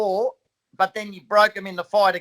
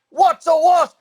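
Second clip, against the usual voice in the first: confused cop shout; 9 semitones higher, 5 dB louder, slower than usual. Retold or imitated. Cop's reply retold.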